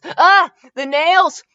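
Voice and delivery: high, loud, drawn-out yelp; sudden protesting shriek; alarmed exclamation. A narrator's reading. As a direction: cry out to protect the nails. Recoils from hand being touched.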